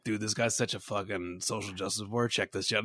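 low, hushed aside; confiding in friends. Acted. Guy talking to his other friends, low confidential aside. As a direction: imitate a guy quietly tipping off friends. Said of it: "talking to his other friends".

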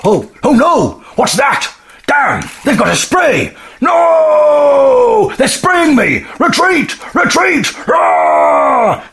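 high panicked bear shouting; a sprayed bear yelling in panic, pitched high. Pretend. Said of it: "We're entering the mind of a bear".

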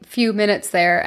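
usual speaking voice

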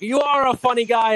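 high, loud wiseguy line; mobster impression. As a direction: act out the famous line. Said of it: "Henry's like".

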